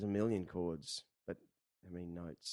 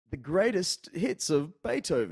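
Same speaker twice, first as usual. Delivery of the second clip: slow, louder TV ad pitch, pitched up; TV record ad; 7 semitones higher, 6 dB louder, slower than usual. Retold or imitated. Recalling K-Tel record ads on TV, slow announcer pitch. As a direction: lightly mimic an announcer selling records. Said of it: "they talk about".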